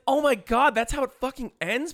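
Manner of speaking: replaying the shock of the ending; shocked disbelief. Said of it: "I was like".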